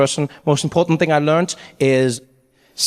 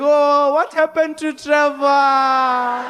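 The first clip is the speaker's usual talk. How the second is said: put-on slow, high, wailing old grandmother; a high, slow, dismayed granny voice; grandmother lament; 9 semitones higher, much slower than usual. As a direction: mourn him like a heartbroken gran. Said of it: "My grandmother saw me".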